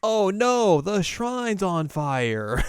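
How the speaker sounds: loud, high, drawn-out wail; hammed-up cry of dismay; mock alarm. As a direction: wail it slowly in overdone panic. Kagome reacts to shrine fire.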